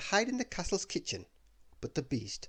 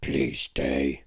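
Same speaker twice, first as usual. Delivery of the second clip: much lower, louder pleading beast voice; begging beast; 12 semitones lower, 8 dB louder than usual. Acted. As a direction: beg her to stay. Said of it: "the beast begged".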